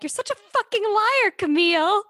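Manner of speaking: high, shrill-pitched mock outburst; imitating an angry castmate; mock accusation. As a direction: fling it like a reality-show blowup. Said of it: "Kyle's like".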